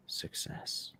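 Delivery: breathy whisper of "success"; whispered punchline. Real, not acted. Finishing the knife-sharpening lecture to viewers with a whispered "success". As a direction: whisper the payoff word.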